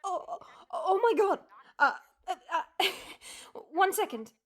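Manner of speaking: stunned surprise. Acted. Shocked by the caller.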